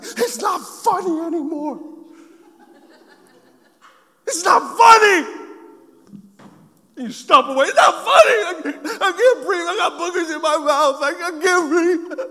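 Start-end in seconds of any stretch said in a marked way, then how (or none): 0.0-5.4 put-on high, rising child's yell; half-laughing kid's protest, getting louder; retold child's protest. Child yelling at laughing father. 7.7-12.2 put-on high, breathless kid pleading; mock-gasping little boy; choking child.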